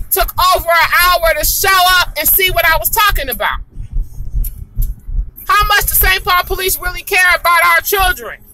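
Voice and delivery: angry outburst. Real, not acted. Angry resident calling out the police over kids, shouting. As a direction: let the anger boil over at the police.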